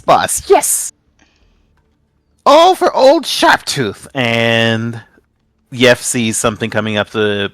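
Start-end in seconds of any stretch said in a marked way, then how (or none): 0.0-4.1 much higher, squeaky eager creature shout; eager minion. Acted. A minion answers its boss's order, high, loud and eager. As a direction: yelp it back like a devoted little lackey.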